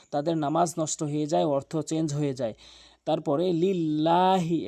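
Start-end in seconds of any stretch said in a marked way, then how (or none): 3.5-4.7 "লিল্লাহি" with long held laa; lengthened LAM. Shown as a demonstration. Teacher shows one-alif length, "লিল্লাহি" drawn out. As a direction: stretch the middle vowel of "লিল্লাহি". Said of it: "লাম অবশ্যই এক আলিফ টেনে পড়বেন".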